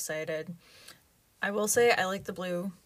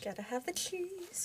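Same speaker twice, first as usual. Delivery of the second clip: mock high echo; 11 semitones higher, 7 dB quieter than usual. Echoing husband, high falsetto.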